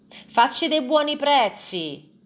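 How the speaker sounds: loud, slow, high-pitched yell from the crowd; shouted. A narrator's reading. A buyer in the crowd shouting at the merchant, loud and slow. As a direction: bellow it out slowly at the seller. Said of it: "urlò uno".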